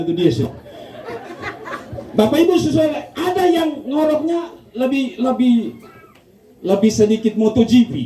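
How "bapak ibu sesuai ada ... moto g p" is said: mock snore. Preacher imitates motorbike snore.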